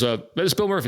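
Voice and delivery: a hint of a hesitant caller, up high; quoting Bill on the phone. Phone call quote from Bill.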